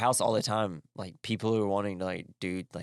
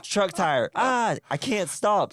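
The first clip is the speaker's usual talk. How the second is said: mock panic; 9 semitones higher, 4 dB louder, slower than usual. Crash scene acted.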